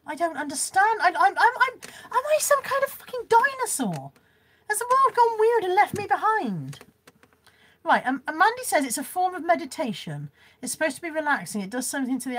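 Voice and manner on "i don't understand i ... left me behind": exasperated. Real, not acted. Baffled by viewers' internet slang.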